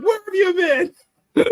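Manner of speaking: excited greeting. Retold or imitated. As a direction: echo it back with extra delight. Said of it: "I'm like".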